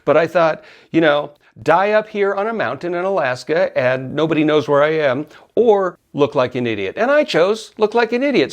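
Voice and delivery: ordinary delivery, nothing marked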